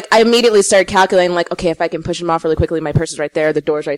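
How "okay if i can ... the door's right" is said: racing thoughts. Retold or imitated. Panicked escape plan in her head.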